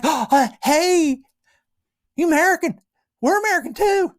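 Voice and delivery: high, loud, over-eager hail; excited greeting. Acted. Running over to a fellow American, excitedly.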